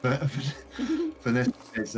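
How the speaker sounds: usual speaking voice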